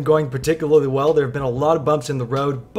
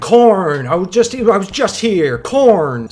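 much louder, higher, odd put-on voice; insistent old man; 4 semitones higher, 8 dB louder, much slower than usual. Old gentleman insisting on corn to the colleague, hammed-up. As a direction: bellow corn like a stuck record. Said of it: "And the gentleman replies".

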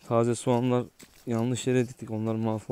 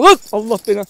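angry yell; 8 semitones higher, 13 dB louder than usual. Real, not acted. Yelling at the misbehaving dog.

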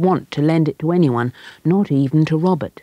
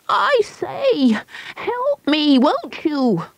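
put-on high boyish plea for help; boy yelling; 12 semitones higher, much slower than usual. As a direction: plead high like a stuck boy. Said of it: "yelled the boy".

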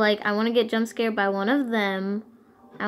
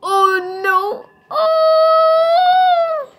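rising high shrieks of fear; frightened screams; 10 semitones higher, 10 dB louder, much slower than usual. Real, not acted. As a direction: scream louder and higher each time. Screams during jump scare.

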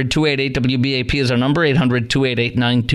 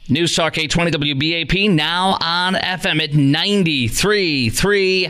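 higher, drawn-out radio host delivery; radio announcer; 6 semitones higher, slower than usual. Acted. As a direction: stretch it out like a station ident. Station ident and segment introduction.

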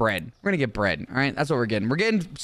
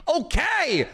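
high, chirpy customer-service send-off; see the customer off in a pitched-up voice; eager shopkeeper; 11 semitones higher, slower than usual. Pretend. Shopkeeper thanking a buyer, eager high voice.

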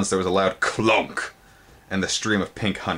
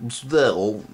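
gross mother voice; 4 semitones higher than usual. Acted. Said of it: "she added softly".

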